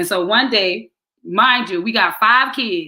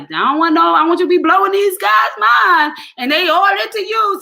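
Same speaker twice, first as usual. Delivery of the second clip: loud, high, emphatic advice; mock shout; 8 semitones higher, 5 dB louder than usual. Friend hyping her up, loud emphatic shout.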